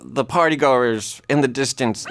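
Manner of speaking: ordinary delivery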